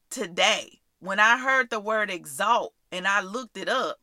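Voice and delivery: usual speaking voice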